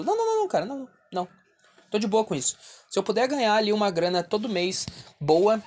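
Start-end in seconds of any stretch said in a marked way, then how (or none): 0.0-1.3 squeaky rapid denial; high emphatic no. Real, not acted. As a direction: deny it in a high rush.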